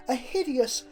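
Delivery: usual speaking voice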